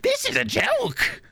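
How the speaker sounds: Weevil voice. Pretend. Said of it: "Weevil walks up on stage".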